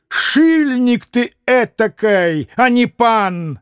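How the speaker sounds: high, drawn-out denunciation; scornful outburst; indignant insult. Acted. Landlord insults the cheating pan.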